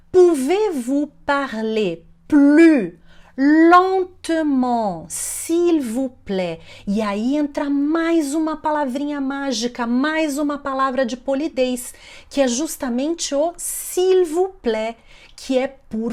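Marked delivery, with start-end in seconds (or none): 0.1-6.6 loud, very slow model phrase; slow teaching demo. Shown as a demonstration. 13.6-15.0 higher, drawn-out model phrase; slow teaching demo. Shown as a demonstration. Teacher models polite word slowly.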